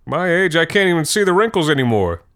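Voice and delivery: jovial quip. Retold or imitated. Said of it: "he'd say something like".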